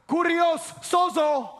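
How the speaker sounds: "Kurios sozo" said slow and loud, Greek style; Greek words. Shown as a demonstration. Pastor gives the Greek of Lord save me, slow loud Greek. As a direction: call out "Kurios sozo" slowly. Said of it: "In Greek, it would have been two words".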